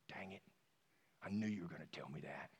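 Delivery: quiet, low, dejected voice; dejected young man. Retold or imitated. Young man to pastor, quietly dejected on the phone. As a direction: sigh it out, caught and resigned. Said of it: "I could hear the tone of his voice".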